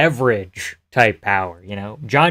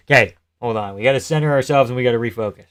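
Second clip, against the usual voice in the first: ordinary talk, nothing marked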